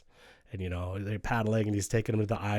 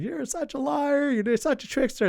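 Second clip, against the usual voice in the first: very high, slow, scolding voice; eldest sister scolding; 4 dB louder than usual. Retold or imitated. Eldest sister calling Raven a liar, high and scolding. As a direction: scold him high and deliberate.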